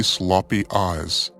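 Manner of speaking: usual speaking voice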